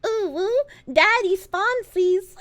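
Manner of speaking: high, slow, mock-seductive coo; mock flirty voice. Streamer mocking an unwanted sponsor, mock flirty coo. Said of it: "I'm gonna have to be like".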